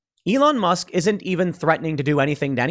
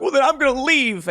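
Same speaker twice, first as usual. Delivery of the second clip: put-on much higher, sulky kid voice; whiny kid; 10 semitones higher than usual. Whiny kids threatening to quit Twitter, sulky mock whine. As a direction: pout and threaten to leave. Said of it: "all the whiny kids are like".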